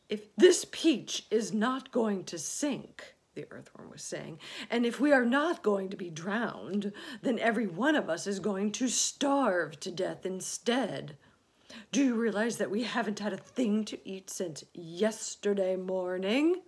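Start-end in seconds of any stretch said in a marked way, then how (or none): 0.1-3.1 loud, high, slow lament; doom-laden complaining character; gloomy earthworm, alarmist. Acted. 4.7-16.6 doom-laden complaining character; gloomy earthworm, alarmist. Acted.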